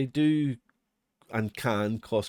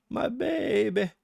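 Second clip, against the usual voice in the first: high, drawn-out coo; high-pitched doting coo; cooing affection; 11 semitones higher, slower than usual. Pretend. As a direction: gush slowly like over a beloved pet. Gushing over a favourite library.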